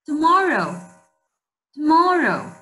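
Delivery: Tomorrow said loud and high, clearly for repeating; modelled word, said loud. Shown as a demonstration. Teacher to class, modelling the word, loud and clear. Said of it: "teacher models word for class".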